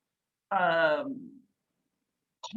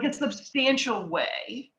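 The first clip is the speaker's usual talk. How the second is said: usual speaking voice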